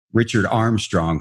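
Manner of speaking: usual speaking voice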